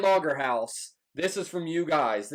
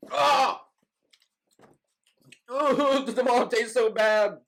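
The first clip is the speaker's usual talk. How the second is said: higher, louder, pained from the heat; mouth burning from spicy food; 7 semitones higher, 4 dB louder, much slower than usual. Real, not acted. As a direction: groan through a burning mouth. After eating hottest sauce.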